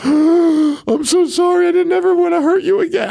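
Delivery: a frantic dad nearly in tears; panicked crying apology. Retold or imitated. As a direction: beg the baby's forgiveness through tears. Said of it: "I'm, like, crying, practically".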